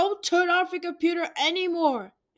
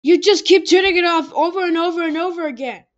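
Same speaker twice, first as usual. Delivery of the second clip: fast, louder exasperated rant; fed up; 4 semitones higher, 7 dB louder, faster than usual. Acted. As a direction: rattle it off, sick of it. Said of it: "and I'm sick of it".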